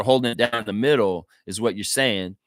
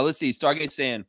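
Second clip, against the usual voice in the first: ordinary delivery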